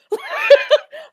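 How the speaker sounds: high, loud burst of laughter; delighted laugh. Real, not acted. Host laughing with delight at the guest's story. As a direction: cackle with joy at the story.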